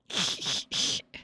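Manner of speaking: short breathy laugh; a quick, breathless mocking laugh. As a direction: laugh at the captive under your breath. Character laughs at captive.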